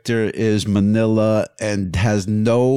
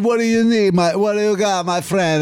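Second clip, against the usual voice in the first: hammed-up Italian accent, higher; Italian pizza guy; 7 semitones higher than usual. Craving a real Italian pizza guy, mock Italian accent. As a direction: greet me like a jolly Italian pizza man. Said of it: "I want the guy going".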